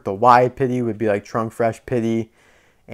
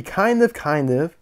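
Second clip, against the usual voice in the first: "kind of" pushed higher, then repeated; stress on KIND OF; 6 semitones higher than usual. Real, not acted. Reviewer qualifying which cars share the model, stressing "kind of". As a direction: lean hard on "kind of" as a hedge. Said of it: "I stress the word kind of".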